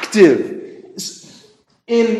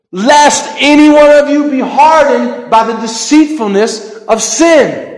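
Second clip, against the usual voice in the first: louder, higher, driving scripture quote; fervent preaching; 7 semitones higher, 6 dB louder than usual. Shown as a demonstration. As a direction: proclaim it with full force. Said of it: "it is so hard for me to talk slow".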